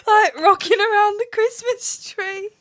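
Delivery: high, loud laughing explanation. Real, not acted. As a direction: giggle helplessly while explaining.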